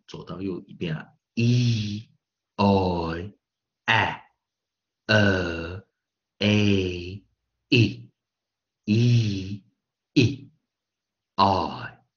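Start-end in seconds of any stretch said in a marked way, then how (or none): 1.3-12.0 loud single vowels from "E" to "I", evenly spaced; vowels only. Shown as a demonstration. Teacher running vowels left to right, loud deliberate drill. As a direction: call out E, O, A and the rest as isolated sounds. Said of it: "我们只念元音".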